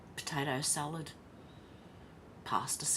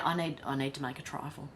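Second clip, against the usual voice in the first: usual speaking voice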